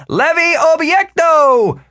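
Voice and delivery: loud, very high, drawn-out spell shout; cried out. A narrator's reading. Wizard aiming his staff at the tree, crying out. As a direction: shout the incantation slowly at full pitch. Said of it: "and cried".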